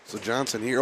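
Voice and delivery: ordinary talk, nothing marked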